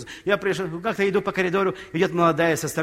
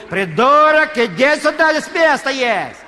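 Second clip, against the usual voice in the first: высокий, громкий окрик другу через стадион; орать что есть силы, зовя друга; крик через всё поле; 8 semitones higher, 7 dB louder, slower than usual. Retold or imitated. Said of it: "он кричал". (истошно кричит через всё поле, растягивая «придурок»)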